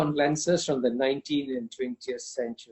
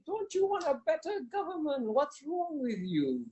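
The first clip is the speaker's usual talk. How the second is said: mock condescension; 12 semitones higher, 3 dB quieter than usual. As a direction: talk down to them like a would-be saviour. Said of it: "that's a sort of condescension".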